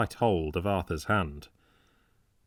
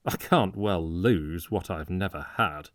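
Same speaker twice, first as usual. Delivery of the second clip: usual speaking voice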